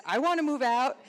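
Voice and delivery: parroting his line like an echo; imitating echo. Teacher parroting student's wish to move out, mimicking. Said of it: "And she says".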